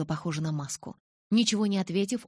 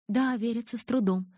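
ordinary delivery

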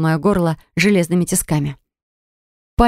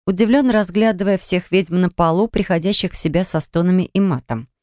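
ordinary delivery

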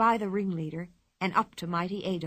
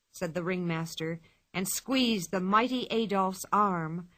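plain reading voice

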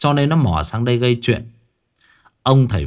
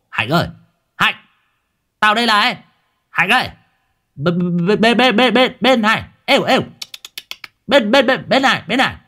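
calling out; 10 semitones higher than usual. Acted. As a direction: call him over like a dog. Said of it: "đang gọi mình như là gọi chó". (gọi to lắp bắp)

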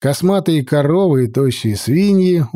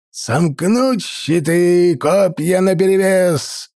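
slow, drawn-out higher commands; gruff barked parade orders; 4 semitones higher, slower than usual. Acted. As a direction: call the shield wall firmly. Commander orders shield wall.

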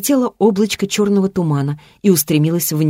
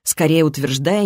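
usual speaking voice

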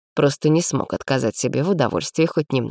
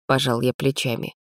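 ordinary delivery

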